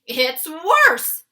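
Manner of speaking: loud dismayed cry of the giant; the giant bursts out in alarm; crying out as the BFG. Acted. The BFG cries out to Sophie, loud and dismayed. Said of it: "cried the B F G".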